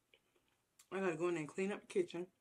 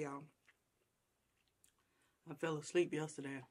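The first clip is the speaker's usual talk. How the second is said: normal talking voice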